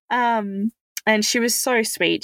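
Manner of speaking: ordinary talk, nothing marked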